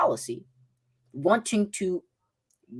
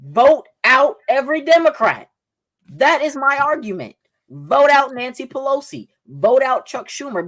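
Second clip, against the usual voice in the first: high, loud political exhortation; emphatic rallying call; 7 semitones higher, 5 dB louder than usual. Real, not acted. Debater urging viewers to vote, emphatic and raised. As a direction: drive each demand home, pitched up and forceful.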